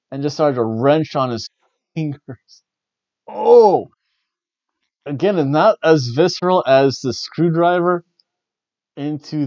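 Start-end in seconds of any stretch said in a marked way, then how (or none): 3.3-3.9 sudden high, loud "Oh!" of wincing; pained gasp. Real, not acted. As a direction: react like it hurts to watch. Wincing at finger wrench.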